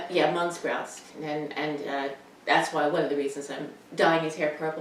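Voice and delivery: normal talking voice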